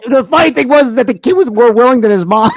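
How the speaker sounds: loud, high, laughing through the words; real laughing. Real, not acted. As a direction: barely get the story out for laughing. Cracking up at own story.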